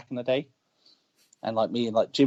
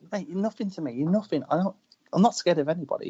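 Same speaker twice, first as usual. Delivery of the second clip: higher-pitched dismissive retort, lightly acted; retold defiance; 6 semitones higher than usual. Wrestler facing down a heckler, retold defiance. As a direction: brush him off as no threat.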